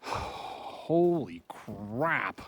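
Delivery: sighing, very slow relieved exhale; real slow sighed relief; exhausted relief. Real, not acted. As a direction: breathe it out as the fear drains. Relief after the bear leaves.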